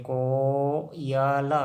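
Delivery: Coil broken into को-य-ल with a long को; very slow teaching breakdown of coil; sounded out slowly. Shown as a demonstration. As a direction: draw coil out syllable by syllable.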